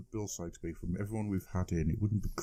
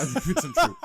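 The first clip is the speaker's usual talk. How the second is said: mock indignant; 8 dB louder than usual. Mock-indignant echo of co-host.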